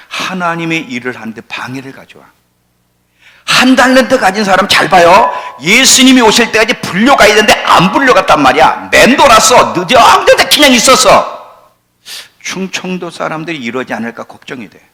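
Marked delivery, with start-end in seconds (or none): 3.4-11.7 high, loud shouted sermon; forceful preaching. Real, not acted. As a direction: cry out the warning at full voice.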